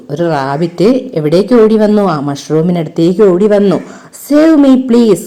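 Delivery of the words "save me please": much higher, pleading like a scared rabbit; crying for help. Acted. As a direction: beg to be saved, frightened. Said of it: "he cried". (പേടിച്ച മുയൽ രക്ഷിക്കാൻ കരയുന്നു)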